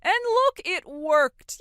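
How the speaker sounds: high, loud burst of vindication; triumphant self-justification. Acted. Mother defending how she pushed daughter out, triumphant. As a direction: crow it, pitched right up.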